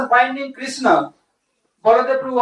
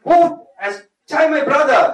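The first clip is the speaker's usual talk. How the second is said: mock-ferocious demand, pitched up; retold indignant outburst; very angry; 5 semitones higher, 4 dB louder than usual. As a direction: act out an angry brother's challenge. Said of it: "became very angry".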